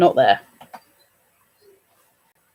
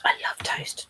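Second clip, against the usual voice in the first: whisper; 9 dB quieter than usual. Real, not acted. Whispered confession of loving toast.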